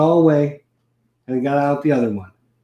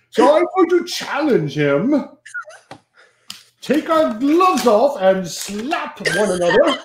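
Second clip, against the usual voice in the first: a hammed-up fake British accent, higher and slow; terrible British accent; 5 semitones higher, slower than usual. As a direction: play a pompous rival in a bad British accent, drawn out. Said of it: "That's a terrible British accent".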